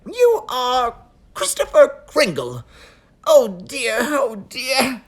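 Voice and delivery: high, loud nervous elf; fretting little elf official; fussy elf voice. Acted. Elf character voice.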